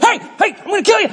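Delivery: very high-pitched mock threat; mock yelling. Human friend yelling at people like a dog, high mock yell. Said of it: "yelling at people".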